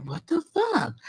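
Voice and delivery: high, stunned exclamation; shocked gasp of disbelief. Retold or imitated. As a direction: girlfriend stunned by his outburst. Shocked at boyfriend's outburst.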